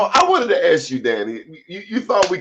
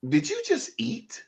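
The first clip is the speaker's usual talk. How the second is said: higher, slow disbelieving question; incredulous; 5 semitones higher, 4 dB quieter than usual. Retold or imitated. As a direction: ask as if offended. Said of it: "and you like".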